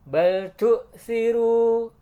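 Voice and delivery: Bal tu siru with a thin th sound; soft tha. Shown as a demonstration. Teacher chants for students with a thin th. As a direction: chant it slowly, light th. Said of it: "Perhatikan huruf sa".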